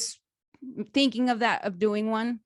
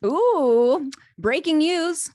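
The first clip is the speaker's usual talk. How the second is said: high, loud teasing announcement; a playful breaking-news call; excited breaking news; 9 semitones higher, 8 dB louder, much slower than usual. Real, not acted. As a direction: announce it like a hyped newsreader. Host teases product announcement.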